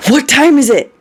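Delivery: high, loud, alarmed question; terrified. A narrator's reading. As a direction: demand it in sudden panic. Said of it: "He is absolutely terrified".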